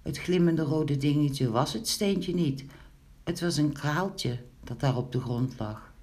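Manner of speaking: plain reading voice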